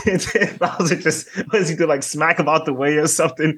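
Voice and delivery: laughing through the words, high pitched; high, amused retelling broken by laughter. Real, not acted. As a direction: tell it while cracking up. Laughing while retelling scene.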